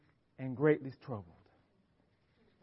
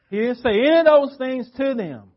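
raised, louder and higher, stressing those; emphatic preaching; 6 semitones higher, 8 dB louder than usual. Real, not acted. Preacher to his church, a raised emphatic declaration. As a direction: declare it to the whole congregation.

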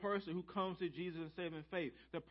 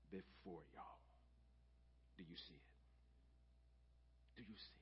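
hushed; 12 semitones lower, 25 dB quieter than usual. Real, not acted. Preacher hushing the congregation to a near whisper.

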